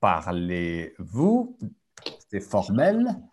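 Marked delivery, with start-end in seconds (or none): 0.0-1.6 parlez-vous drawn out slowly, each syllable clear; teacher stretching out parlez-vous; slow model pronunciation. Shown as a demonstration. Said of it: "Like, parlez".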